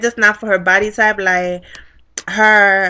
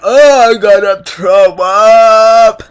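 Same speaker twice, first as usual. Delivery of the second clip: put-on drunk girl voice; 6 dB louder, much slower than usual. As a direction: play her about to throw up. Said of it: "she's like".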